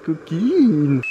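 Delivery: loud, playful scold; mock-scold the naughty crayfish; teasing. Real, not acted. Taquine les écrevisses. (gronderie taquine et forte)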